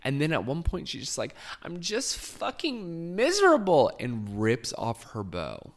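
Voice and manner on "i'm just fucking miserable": high, loud mock-miserable wail; Tinsley Mortimer. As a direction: imitate an inconsolable drunk, building to a loud cry. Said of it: "is completely inconsolable".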